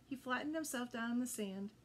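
plain reading voice

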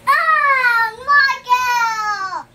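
loud sudden yell; shouting. Real, not acted. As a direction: yell the name out. Kids shout during sour challenge.

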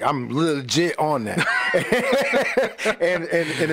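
laughing through the words, high giggle; amused laugh breaking into the story. Real, not acted. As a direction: crack up mid-sentence. Laughing at his own Con Air flight.

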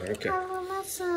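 high, very slow mock I don't care; mock drawn-out. Shown as a demonstration. Dad repeats "I don't care" to the kids in a mock drawn-out voice.

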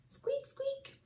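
tiny, very high mouse squeak; high, soft animal voice. A narrator's reading. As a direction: squeak softly like a little mouse. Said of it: "She seemed to be yelling".

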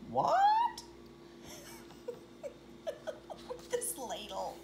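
ordinary delivery, nothing marked

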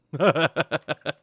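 short burst of laughter; laughing. Real, not acted. Player amused by teasing the villager, laughing. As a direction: laugh at your own joke.

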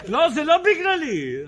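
indignant protest. Acted. Water carrier protesting.

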